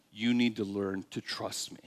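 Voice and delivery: low, hushed, intimate voice; soft earnest counsel. Acted. Preacher as God teaching Moses trust, soft and earnest.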